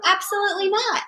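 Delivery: firm refusal. Real, not acted. Mother repeating her no to her child, insistent. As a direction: say it again and mean it.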